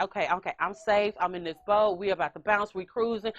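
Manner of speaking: low-pitched, cocky imitation of a seal; cool little show-off, voiced low; cheeky seal. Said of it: "he was a little cheeky".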